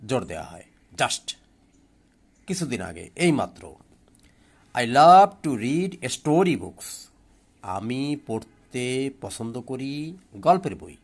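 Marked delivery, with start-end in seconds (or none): 4.7-6.9 "story books" said louder and slow; stress on story books. Shown as a demonstration. Teacher reading the sentence aloud, stressing "story books" slowly and loudly. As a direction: lean on "story books" as the bold words. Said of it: "গল্পের বইয়ের ওপর জোর দেওয়া হয়েছে".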